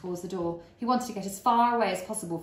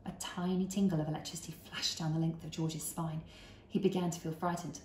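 plain reading voice

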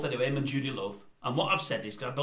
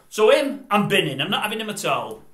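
louder, firm dismissal; emphatic verdict; 3 semitones higher, 8 dB louder than usual. Real, not acted. Vlogger rejecting Paddy McGuinness, loud and firm. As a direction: bin him out loud.

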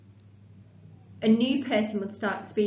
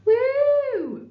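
excited cheer; 8 dB louder than usual. Acted. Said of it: "cried Mark Michael".

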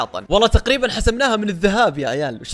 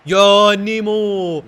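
very slow stretched cheer, louder; drawn-out call; 3 semitones higher, 6 dB louder, much slower than usual. Real, not acted. (نداء ممطوط طويل بحماس)

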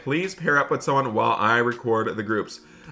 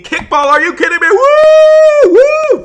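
loud, high whooping cheer; thrilled shout; 13 dB louder, slower than usual. Pretend. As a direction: scream for joy like your wish came true. The next unit is kickball.

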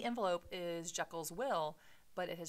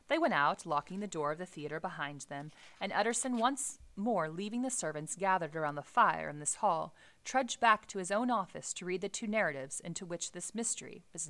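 reading the novel aloud with the characters' quotes a bit louder; story reading; 6 dB louder than usual. Acted. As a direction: read it like a classic novel for the class. Teacher reads novel passage aloud.

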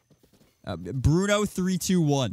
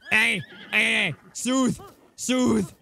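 calling out; 9 semitones higher, much slower than usual. Real, not acted. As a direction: call out sharply to settle it. Calming a startled horse.

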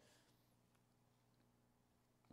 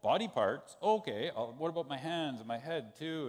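louder, quick surprised "Oh, body parts?"; eager surprise; 5 semitones higher, 7 dB louder than usual. Retold or imitated. Peter misunderstanding Jesus about body parts, eager surprise. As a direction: catch on wrongly and get excited. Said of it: "So then Peter's like".

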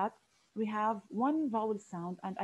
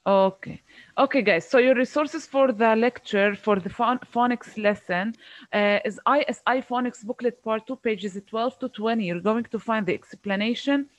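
loud, projected teacher voice; raised voice; 8 dB louder than usual. Real, not acted. Teacher giving resources after hearing complaint, raised voice. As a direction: project clearly to the whole class. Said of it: "is it better?".

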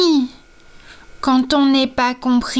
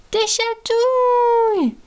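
high, slow, delighted squeal of a jellyfish; excited cry; 10 semitones higher than usual. A narrator's reading. Jellyfish shouting with joy at her waking friends. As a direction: stretch out the word with glee. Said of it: "Crie Camille la méduse".

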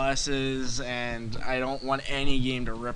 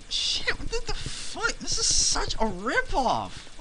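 high squeal, then slow and drawn out; hammed-up falsetto complaint; mock outraged squeak; 14 semitones higher, slower than usual. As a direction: squeak it in fake disbelief. Mock outrage at a rip-off game.

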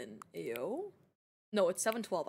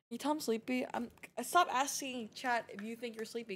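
ordinary talk

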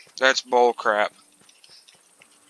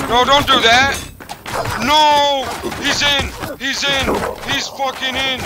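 high, loud panicked yells; panicked shouting; 13 semitones higher, 6 dB louder, slower than usual. Real, not acted. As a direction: yell as the enemy breaks in. Enemy breaks through.

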